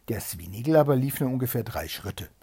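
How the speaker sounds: usual speaking voice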